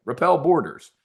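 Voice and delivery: ordinary delivery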